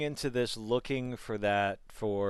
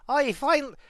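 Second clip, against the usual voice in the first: much higher, louder comic caricature; 7 dB louder than usual. Retold or imitated. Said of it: "the actor's voice was just like".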